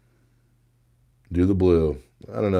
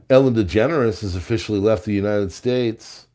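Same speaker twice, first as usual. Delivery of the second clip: ordinary talk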